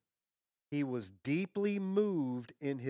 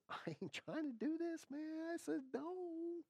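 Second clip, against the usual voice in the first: mock pleading; 8 dB quieter than usual. Preacher retelling Jesus' plea to his Father, high soft mock pleading. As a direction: imitate a reluctant son pleading, pitched way up.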